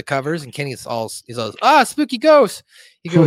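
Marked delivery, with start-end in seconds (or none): 1.6-2.6 high, loud, drawn-out cartoon wail; hammed-up scare; mock fright. Cartman seeing Kenny's ghost, high mock fright.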